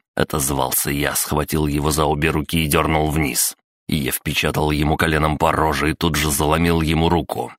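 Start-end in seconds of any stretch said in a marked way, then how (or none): none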